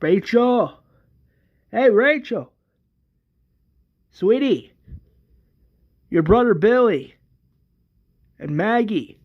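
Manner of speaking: mild shout to find someone; calling out for someone. Acted. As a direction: call through the house for your daughter. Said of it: "screaming for Rachel".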